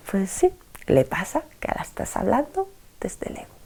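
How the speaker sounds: ordinary delivery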